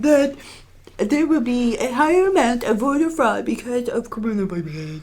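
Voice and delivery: high, put-on politician voice; Donald Trump. Podcaster quoting Trump on mail-in voting, mock Trump impression. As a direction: keep up a hammed-up presidential impersonation. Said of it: "This is my Trump impression".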